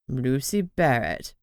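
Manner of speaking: in wonder. A narrator's reading. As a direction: breathe her name in awe. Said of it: "Terence said in wonder".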